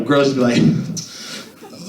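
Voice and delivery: ordinary talk, nothing marked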